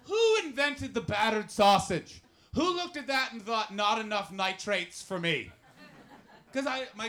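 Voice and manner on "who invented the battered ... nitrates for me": high, loud incredulous rant; slow, shouted rhetorical question; mock outrage. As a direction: demand answers in hammed-up disbelief. Comic outrage at battered sausage.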